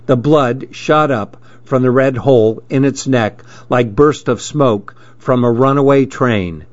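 usual speaking voice

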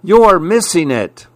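high-pitched plea for attention; urgent calling. A narrator's reading. Son calls his dad away from the phone, urgent. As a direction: call out to someone who isn't looking.

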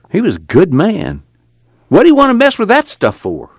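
high, loud, upset protest; acted exasperated outburst. A narrator's reading. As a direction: build to a high-pitched complaint. Pa upset over Casey's trouble.

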